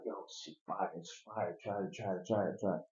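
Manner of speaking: secretive whispered coaxing; hushed pitch. Retold or imitated. Pushing the drug on people.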